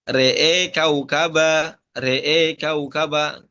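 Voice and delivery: RE'E KAUKABA slowly, long e in re'e; imalah. Shown as a demonstration. Teacher demonstrating the reading twice, slow imalah in RE'E. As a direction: draw out "re'e", both vowels tilted to e. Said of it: "Amala fathatur ra wal hamzah".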